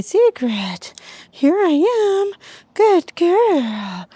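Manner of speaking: high, slow, coaxing call to the puppy. Shown as a demonstration. Trainer showing how she calls Secret back in a high voice. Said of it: "I just say".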